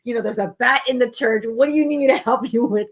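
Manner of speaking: baffled. Retold or imitated. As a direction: play along, puzzled at the fuss. Baffled reply to panicking coordinator.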